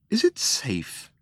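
hushed, drawn-out question; uneasy question. A narrator's reading. Arthur asks about the dead planet, hushed and uneasy. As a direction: ask it slowly, almost in a whisper.

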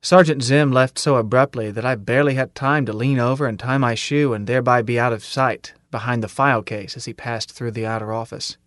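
ordinary delivery

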